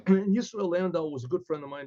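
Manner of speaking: ordinary delivery, nothing marked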